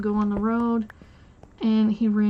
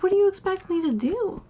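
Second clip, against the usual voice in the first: exasperated outburst; 9 semitones higher, faster than usual. Retold or imitated. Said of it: "I was like".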